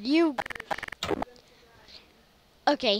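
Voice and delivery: normal talking voice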